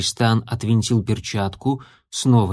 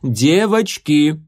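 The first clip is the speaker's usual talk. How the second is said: put-on slow, louder call to the girls; 4 semitones higher, 4 dB louder, much slower than usual. A narrator's reading. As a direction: call them to order. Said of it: "окликнула их директриса".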